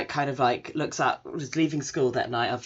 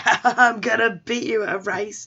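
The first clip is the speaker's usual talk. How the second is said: high, louder taunting laugh into a challenge; cocky challenge; 7 semitones higher, 4 dB louder than usual. Retold or imitated. Rival boy taunting his friend into a race, high and cocky. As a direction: crow at him like a cocky rival. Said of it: "he's like".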